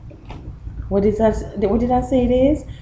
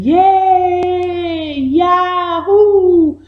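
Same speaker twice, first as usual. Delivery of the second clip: high, loud happy cheer; joyful cheer; 11 semitones higher, 6 dB louder, much slower than usual. Shown as a demonstration. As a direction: cheer like a thrilled child. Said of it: "Joy is saying".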